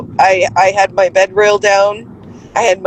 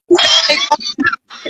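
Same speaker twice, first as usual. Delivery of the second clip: very high, slow squeaky imitation; mock high voice. Mother imitates the staff calling her out, very high. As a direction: squeak it out theatrically. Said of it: "They said".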